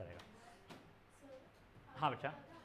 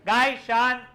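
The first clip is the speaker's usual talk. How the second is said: hushing the class; 3 semitones higher, 9 dB louder than usual. Real, not acted. Teacher loudly telling a chattering class to settle, in a loud voice. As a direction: shout the class down to silence.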